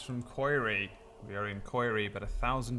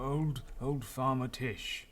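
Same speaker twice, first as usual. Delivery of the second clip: put-on slow old man voice; 4 semitones higher, slower than usual.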